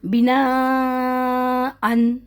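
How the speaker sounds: slow drawn recitation of بنا ان with nasal ending; Quran recitation demo. Shown as a demonstration. Teacher reciting بنا ان slowly to her students. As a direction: recite بنا ان slowly, nun sounded at the end. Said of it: "دیکھیں".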